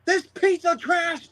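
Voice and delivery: high, slow commentator impression; Pat McAfee. Commentator trashing Dominic for the betrayal, high imitation. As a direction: pitch it way up and stretch the disgust. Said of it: "Pat McAfee was like".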